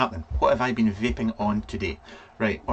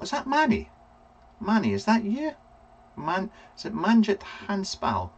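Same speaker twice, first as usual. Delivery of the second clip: surprised; 9 semitones higher than usual. Real, not acted. Spotting a viewer in chat.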